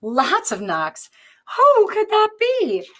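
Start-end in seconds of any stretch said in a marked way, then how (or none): 1.6-2.9 high, loud curious wonder; curious storybook question. A narrator's reading. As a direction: sound truly puzzled. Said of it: "Amu wondered".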